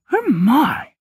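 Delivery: shrill-high shocked little cry; a prim portrait lady caught off guard; scandalised gasp. Acted. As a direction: clutch your pearls, fat lady. Fat lady startled by kissing.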